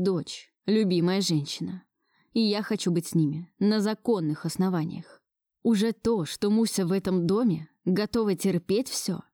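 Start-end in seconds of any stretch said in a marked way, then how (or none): none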